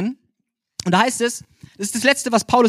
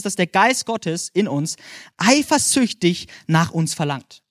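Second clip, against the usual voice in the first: slow emphasis; 3 semitones lower, much slower than usual. Acted. Slowed emphatic scripture quote.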